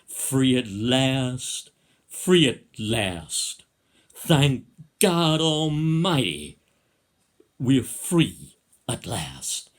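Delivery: loud, slow, drawn-out speech-maker's quote; booming orator. Acted. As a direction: boom it out grandly, stretching every word. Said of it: "in that lovely, deep, booming voice".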